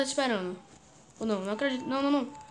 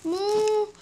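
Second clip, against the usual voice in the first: high, loud frantic yells of morre; yelling at enemy; 7 semitones higher, 3 dB louder, slower than usual. Real, not acted. Gamer yelling at the monster to die, frantic shout. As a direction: scream at the boss to just die. Yelling at boss mid-fight.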